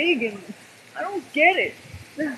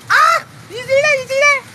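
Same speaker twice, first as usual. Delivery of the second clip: high, loud panicked yelps; real panicked yell at lightning; frightened shriek; 14 semitones higher, 11 dB louder than usual. Real, not acted. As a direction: shriek at the flash, then shout. Lightning strike, startled shriek.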